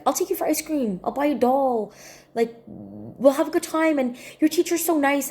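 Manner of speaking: put-on high, singled-out coaxing voice for a child. Aunt bribing a frightened niece with treats, coaxing. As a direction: pitch it up, piling on mock promises.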